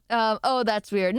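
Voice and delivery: ordinary talk, nothing marked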